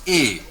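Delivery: A as a closed "ay" without glide; French é sound. Shown as a demonstration. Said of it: "the pronunciation of E becomes".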